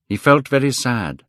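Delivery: ordinary delivery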